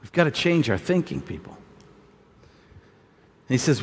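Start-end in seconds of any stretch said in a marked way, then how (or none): none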